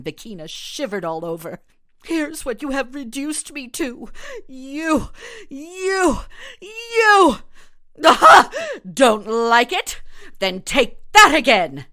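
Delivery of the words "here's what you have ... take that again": rising from tense to a shrieking high yell; building fury. Acted. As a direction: build each "you" higher and louder until she explodes. Vakina lashing out at doctor.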